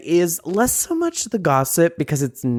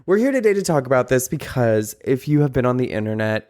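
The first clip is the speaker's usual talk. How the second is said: ordinary delivery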